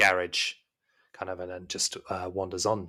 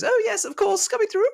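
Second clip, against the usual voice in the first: very high, loud falsetto maid voice; high, eager servant's reply; obliging maid; 6 dB louder than usual. Acted. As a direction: call back brightly from the next room. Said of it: "She goes".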